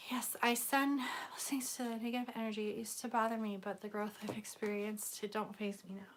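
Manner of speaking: hushed, breathy spiritual whisper; soft whisper. Pretend. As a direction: whisper it like a serene guru. Mock spiritual serene talk.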